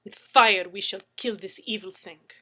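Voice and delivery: loud fierce Russian declaration; vehement. A narrator's reading. Anna vows to destroy the door, loud. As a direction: vow it fiercely. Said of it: "vehement and sharp".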